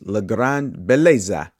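amateur stab at an Italian accent; attempted Italian accent. Shown as a demonstration. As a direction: have a go at an Italian accent on the film title. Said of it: "that was my attempt at an accent".

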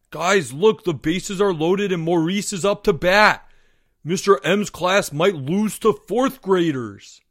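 loud, high eager call; excited shouting. Acted. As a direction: shout the news across the field. Albert calls boys to the game.

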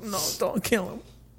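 high, small mock pleading; distressed plea. Retelling distressed plea at the screen.

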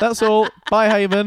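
high, loud, chirpy goodbye; breezy sign-off. Acted. Eunuch taking leave of doomed Haman, breezily cheerful. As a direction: toss off a bright farewell, oblivious to the damage.

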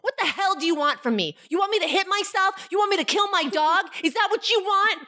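retold furious outburst, pitched high; snapped. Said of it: "that night I snapped".

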